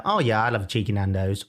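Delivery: quick, offhand and understated; casual response. Acted. Asking co-host how he responds to Nando's, casual. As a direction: say it fast like no big deal. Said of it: "how do you respond when you hear a Nando's? Do you go".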